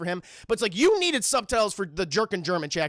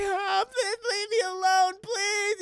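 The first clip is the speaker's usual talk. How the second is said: high, slowed sobbing beg; mock crying plea; 12 semitones higher, slower than usual. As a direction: whimper for mercy. Victim begging, crying voice.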